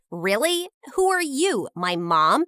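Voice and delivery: ordinary delivery, nothing marked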